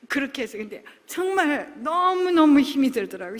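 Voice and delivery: ordinary delivery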